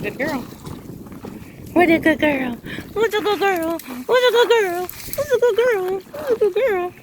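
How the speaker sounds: shower the dog with proud praise; gushing puppy praise. Real, not acted. Puppy climbed out of water.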